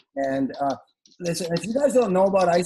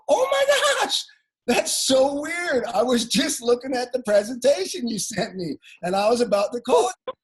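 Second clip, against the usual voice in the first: mock amazed prospect; 6 semitones higher than usual. Voicing the prospect who just saw the presentation, amazed. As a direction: play the prospect amazed at the coincidence. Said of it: "Sean's gonna go".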